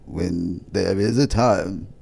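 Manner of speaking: slow, measured mock-prophet delivery; stately spoof oracle; new persona. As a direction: draw the words out like a channeled sage. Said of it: "change my whole persona".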